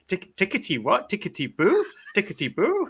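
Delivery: light mock German accent, puzzled; a lightly put-on German accent, questioning. Retelling a German colleague's confusion at the phrase, in a light German accent. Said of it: "Particularly loving your German accent".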